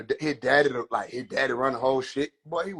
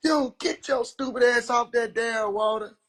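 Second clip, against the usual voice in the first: mama yelling; 7 semitones higher than usual. Acted.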